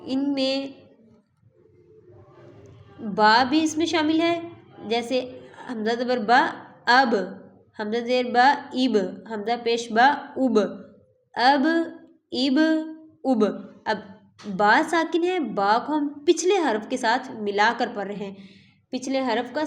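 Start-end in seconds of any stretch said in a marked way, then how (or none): 4.9-14.0 the final ب of اب bounced with a sharp echo; qalqala echo. Shown as a demonstration. Teacher drilling the bouncing qalqala ب for students. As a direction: release the ب in اب with a clear bounce. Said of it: "با جو ہے حروف قلقلہ میں سے ہے".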